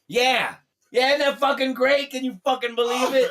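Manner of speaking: loud, high, thrilled phone voice. Retold or imitated. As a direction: gush down the line at high pitch. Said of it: "and [name] goes".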